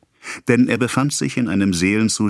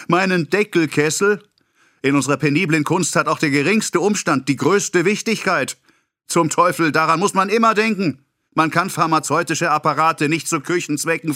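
higher, indignant ranting pharmacist; raging; 10 semitones higher than usual. A narrator's reading. Pharmacist storming at his apprentice over the kettle, higher and fuming. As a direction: fume and lecture at once. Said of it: "röter als seine Johannisbeeren wetterte er".

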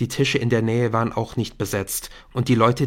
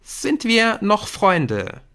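slow, higher-pitched probing question; earnest appeal; 10 semitones higher, 3 dB louder, much slower than usual. Acted. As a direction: ask it slowly, it matters. Jamie tests the friendship. (eindringlich und langsam gefragt)

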